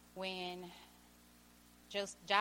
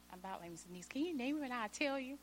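normal talking voice

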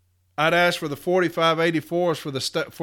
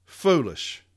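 muttered scorn; 2 semitones lower than usual. Real, not acted. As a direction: shake your head and mutter Foolish. Repeating the verdict to himself.

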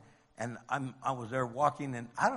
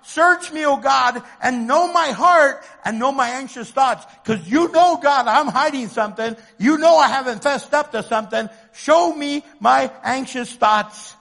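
high, loud pleading with God; full-force preacher's prayer; desperate prayer; 7 semitones higher, 9 dB louder than usual. Acted. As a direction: shout the confession up to God.

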